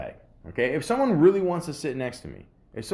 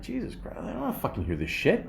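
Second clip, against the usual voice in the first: exasperated groan; 6 semitones higher, 6 dB quieter than usual. Retold or imitated. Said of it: "And I'm like".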